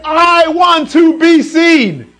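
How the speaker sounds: loud, high, drawn-out cry for attention; mock attention-seeking. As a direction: call it out big and slow, begging for notice. Voicing the Christmas decorations' message.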